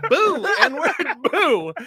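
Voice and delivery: high, loud imitated boo; insistent heckler's boo.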